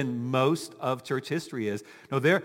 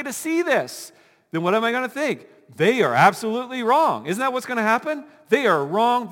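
self-certain believer; 8 semitones higher, 7 dB louder than usual. Acted. Pastor mimicking a believer convinced others are damned, indignant. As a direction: play the zealot who knows he's right.

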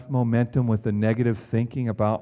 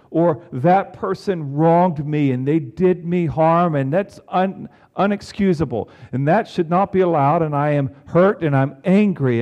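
impassioned preaching; 5 semitones higher, 7 dB louder than usual. Acted. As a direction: preach the anger out with weight. Preaching wronged feelings.

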